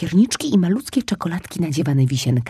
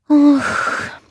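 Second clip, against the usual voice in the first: higher, louder longing sigh; 9 semitones higher, 5 dB louder than usual. Acted. Cub gazing at tasty eggs, longing sigh. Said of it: "westchnął".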